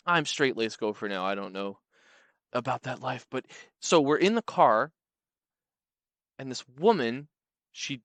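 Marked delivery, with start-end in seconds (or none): none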